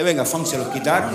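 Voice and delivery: ordinary talk, nothing marked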